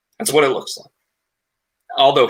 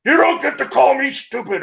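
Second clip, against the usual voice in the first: angry Braun shout; 12 semitones higher than usual. Retold or imitated. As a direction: bellow it like an offended giant. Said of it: "and he's like".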